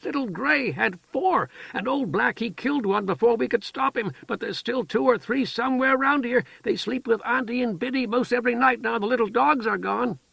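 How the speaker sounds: put-on high, childlike storytelling voice; simple-minded woman chattering. A narrator's reading. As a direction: rattle on like an eager child. Heather explains the kittens.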